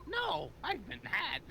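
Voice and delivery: shrill high hammy panic; panicked villain. Pretend. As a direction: squeal in cartoon villain panic. Villain panics, voiced dramatically.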